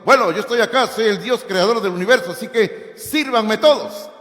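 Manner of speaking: mock arrogant king. Preacher mocking a proud Jesus who demands service, in a loud haughty voice. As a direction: play a haughty ruler ordering everyone. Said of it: "Él no vino con una actitud diciendo".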